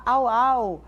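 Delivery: loud playful woof woof; dog barking imitation. Shown as a demonstration. Therapist barking like a dog for a toddler, playful. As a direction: bark like a dog for the child. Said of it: "sons onomatopeicos".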